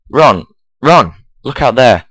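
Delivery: calling out. Acted. Harry waking Ron to see the trolley, urgent calling. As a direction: rouse a sleeping friend.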